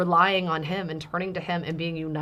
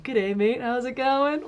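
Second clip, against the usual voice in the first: light, higher mock Australian accent; kids' Australian impression; 7 semitones higher, slower than usual. Kids imitating dad with an Australian accent, higher and cheery. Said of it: "the kids will do impressions of".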